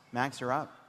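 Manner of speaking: ordinary talk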